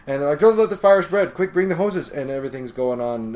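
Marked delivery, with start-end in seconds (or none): 0.4-2.1 high, loud firefighter calls; rally the crew in a hurry; mock-panicked shouting; urgent orders. Acted firefighters calling orders.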